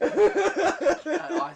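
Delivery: high, loud, slow line through laughter; laughing. Real, not acted. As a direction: laugh through the words slowly. Laughing while recalling scene.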